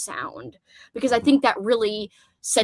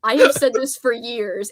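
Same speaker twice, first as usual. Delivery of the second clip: usual speaking voice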